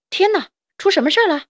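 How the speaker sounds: high, loud, quick exclamation; startled cry. A narrator's reading. Friend jumping out of the straw at the noise, startled cry. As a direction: cry out in alarm, fast and high. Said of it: "惊呼道".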